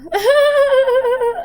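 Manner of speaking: loud, high-pitched burst of laughter; long, high, self-mocking laugh; high emotional laugh. Real, not acted. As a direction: laugh loudly at your own emotion.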